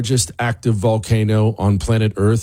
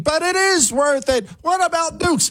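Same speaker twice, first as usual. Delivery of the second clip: mock screaming. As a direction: play an exasperated listener screaming back. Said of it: "Maybe you're sitting there screaming".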